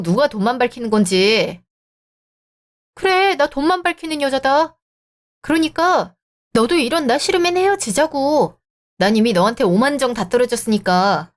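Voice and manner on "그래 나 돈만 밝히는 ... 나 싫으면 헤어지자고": higher, biting sarcastic admission; sarcastic comeback. Acted. Girlfriend throwing boyfriend's insult back, higher and sarcastic. Sarcastic retort in breakup fight.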